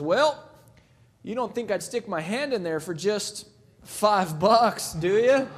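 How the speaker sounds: lightly voiced bumpkin, slow and smug; sly country bumpkin. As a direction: deliver the punchline slowly and slyly. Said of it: "he goes".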